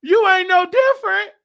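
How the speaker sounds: high, loud, sassy clapback; sassy inner retort. Retold or imitated. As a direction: call her out with attitude. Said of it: "to myself".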